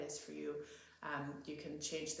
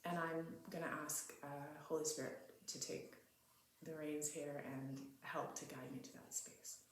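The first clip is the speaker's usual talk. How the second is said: ordinary talk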